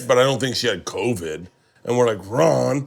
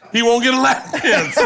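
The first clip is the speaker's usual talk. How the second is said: outraged Ron; 12 semitones higher, 7 dB louder than usual. Retold or imitated. Ron voiced at strip club.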